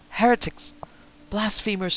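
high, loud outraged shout; old man's scream. A narrator's reading. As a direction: scream it as a fanatical old minister. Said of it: "screamed the old man".

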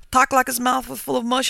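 demanding. A narrator's reading. Farmer checking the boy's identity, demanding. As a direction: demand confirmation excitedly. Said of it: "he demanded".

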